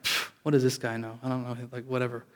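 muted, dismissive aside to yourself; dismissive inner thought. Retold or imitated. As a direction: scoff under your breath, as if only thinking it. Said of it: "I didn't say it out loud, just in my heart".

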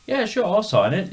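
high, loud Cockney voice; chirpy put-on Cockney; mock Cockney. Imitating the singer agreeing to sign.

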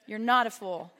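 usual speaking voice

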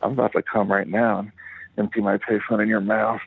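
ordinary delivery

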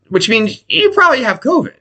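ordinary delivery

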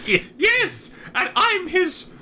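high-pitched, breaking into laughter; high voice, laughing. Acted. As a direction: stammer it out through giggles. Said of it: "[name] starts cracking up".

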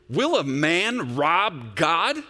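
slow, loud, emphatic reading; read with emphasis. Shown as a demonstration. Pastor reading God's words to the church with heavy emphasis. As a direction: thunder out God's question slowly. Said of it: "I'm gonna read with emphasis".

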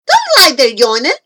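put-on loud, quick, bossy boy; yelling. A narrator's reading. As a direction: call out brisk orders. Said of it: "he yelled".

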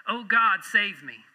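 hammed-up desperate plea to be saved; crying out to God. Atheist thrown from his boat cries out to God, mock desperate. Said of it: "he cries out".

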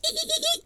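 high chittering dolphin laugh; dolphin noise. Retold or imitated. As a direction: imitate a squeaky dolphin.

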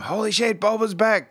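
high excited gasp of joy. Retold or imitated. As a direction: gush like a thrilled fan. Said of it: "how quickly we've gone from".

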